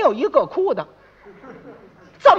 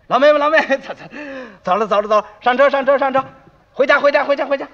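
loud, repeated chivvying calls; urgent hustling; 4 semitones higher, 4 dB louder, faster than usual. Acted. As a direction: herd everyone into the cart. 众人催老妹妹上车回家. (连声大喊着催促)

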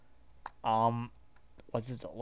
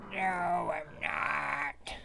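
very slow, higher-pitched denial; drawn-out wail; 4 semitones higher, much slower than usual. Acted. Freddy answering if he's okay, drawn-out wail. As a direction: stretch each word out in distress.

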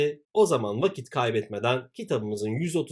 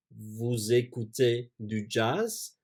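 slow repeat; slower than usual. Shown as a demonstration.

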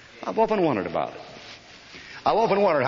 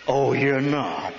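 slow, drawn-out challenge from a captain; old captain; much slower than usual. Retold or imitated. Captain answering a soldier who skips drill, slow challenge. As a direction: draw it out as an army captain's retort. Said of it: "That's what my old captain would say".

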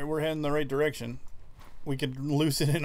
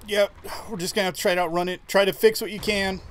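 urgent raised voice under pressure; being chased; 6 semitones higher, 6 dB louder than usual. Real, not acted. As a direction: call it out fast while fleeing.

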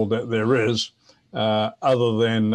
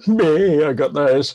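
higher, louder offhand meh; dismissive brag; 4 semitones higher, 5 dB louder, slower than usual. Retold or imitated. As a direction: shrug it off like a seasoned ham who already has them. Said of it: "guys that are watching the channel now go".

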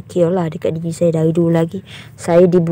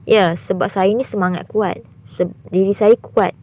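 normal talking voice

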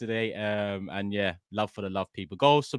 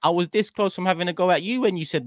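mock outrage; 9 semitones higher than usual. Real, not acted.

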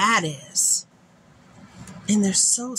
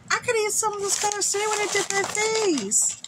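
very high, squealed mock lament; overjoyed whine; 13 semitones higher than usual. Real, not acted. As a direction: wail it in a happy squeal. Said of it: "I'm so happy".

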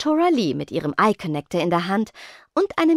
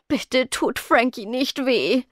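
slow, higher-pitched plea; pleading; 4 semitones higher, slower than usual. A narrator's reading. Hudud begs the girls for Frankie's sake, pleading slowly. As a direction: beg them slowly, in a small plaintive voice. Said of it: "flehte Hudud".

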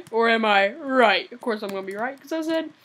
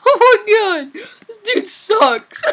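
laughing outburst; 10 semitones higher, 13 dB louder, slower than usual. Real, not acted. Raging at player in game.

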